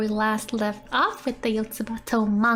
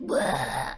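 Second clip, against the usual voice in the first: a low flopped blah sound; lightly hammed-up low blah; low mock blah; 9 semitones lower, 4 dB quieter than usual. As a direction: drop way down, deflated blah. Acted splat sound.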